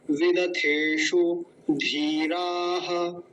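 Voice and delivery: वेदाथेषु धीराः slow, long थे, धी, रा; careful pronunciation demo. Shown as a demonstration. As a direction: draw out वेदाथेषु धीराः with final ha. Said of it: "इस शब्द को बड़े ध्यान से देखेंगे".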